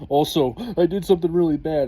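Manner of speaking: sheepish friend. Retold or imitated. Friend confessing.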